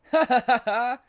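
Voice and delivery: loud high villain cackle; evil laugh. A narrator's reading. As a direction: cackle triumphantly. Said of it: "There was a man laughing".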